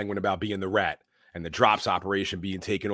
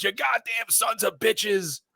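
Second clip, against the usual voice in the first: much higher, slow shouted curse; furious Penguin; 12 semitones higher, slower than usual. Retold or imitated. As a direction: yell the curse after them. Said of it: "he goes".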